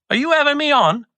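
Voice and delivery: incredulous waiter, pitched well up; high mock disbelief; indignant waiter. The waiter suspects two brothers are teasing him, indignant. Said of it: "he looked at me and he goes".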